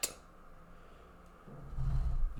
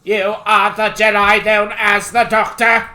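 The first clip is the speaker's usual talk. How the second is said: loud flat robotic Sith voice; monotone machine villain, booming; 3 semitones higher, 8 dB louder than usual. Pretend. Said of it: "Speaks in monotone".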